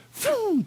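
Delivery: a very high whooshing burst; whoosh sound. Retold or imitated. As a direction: shoot off like a racehorse at the gate. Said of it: "you're like".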